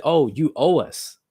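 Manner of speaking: mock France. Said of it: "France came back and said".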